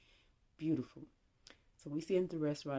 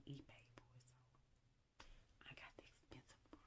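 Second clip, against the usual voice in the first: hushed, confidential whisper; whispered aside; 2 semitones lower, 28 dB quieter than usual. Real, not acted. Confiding to viewers that he paid for the hotel, whispered aside. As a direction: drop right down to a whisper.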